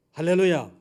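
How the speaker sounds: loud ringing hallelujah; shouted hallelujah. Real, not acted. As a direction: call it out to the congregation. Preacher's acclamation. (회중에게 외치는 할렐루야)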